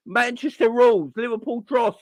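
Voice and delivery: ordinary delivery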